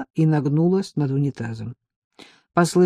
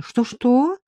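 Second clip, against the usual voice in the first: high, surprised echo question; 7 semitones higher than usual. A narrator's reading. Marika reacting to a cheeky reply, surprised. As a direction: lift it sharply in disbelief. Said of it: "удивилась Марийка".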